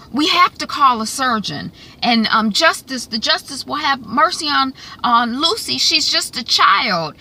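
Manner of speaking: lightly voiced urgent plea; understated begging for the girl; mild pleading. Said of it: "North is like".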